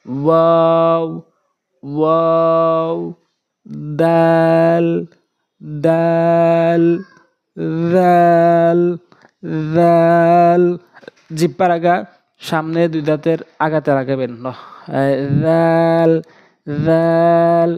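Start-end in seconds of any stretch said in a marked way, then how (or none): 0.1-10.6 each letter held long and louder; letters chanted loud and slow; loud drawn-out letters. Shown as a demonstration. Arabic letter demos, drawn out, louder. 15.0-17.7 each letter held long and louder; letters chanted loud and slow; loud drawn-out letters. Shown as a demonstration. Arabic letter demo, drawn out.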